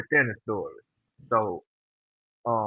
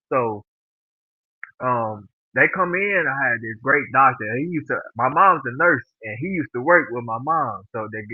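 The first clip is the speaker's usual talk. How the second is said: normal talking voice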